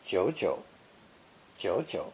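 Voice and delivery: first "jiu" rises, second "jiu" dips low; rising then low tone. Shown as a demonstration. Teacher demonstrating two third tones together, "Jiu jiu" rising then low. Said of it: "a rising tone before dipping into the proper low tone".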